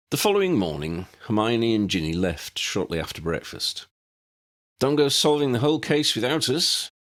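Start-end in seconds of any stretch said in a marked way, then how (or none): 4.8-6.9 higher, playful friendly warning; Harry warning with a smile. A narrator's reading. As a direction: tease them as you warn them. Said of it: "warned them both with a smile".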